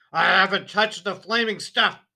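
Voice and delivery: loud, high furious shout; angry shout. A narrator's reading. Father shouting at wife and daughter, loud and furious. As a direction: roar it in outrage. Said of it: "Mister Wormwood shouted".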